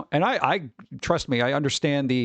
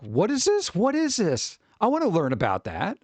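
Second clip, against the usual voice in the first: put-on curious kid; 6 semitones higher than usual. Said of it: "And I was just like".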